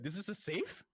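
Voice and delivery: puzzled surprise. Acted. GM voices puzzled blacksmith.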